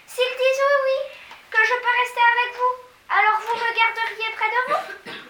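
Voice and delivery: high, loud and pressing; urgent high girl's plea. A narrator's reading. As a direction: push hard, she can't wait. Mathilda pleading on.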